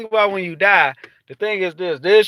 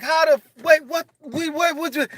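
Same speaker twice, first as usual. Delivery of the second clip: confused stammer; 10 semitones higher than usual. Retold or imitated. Confused waking up.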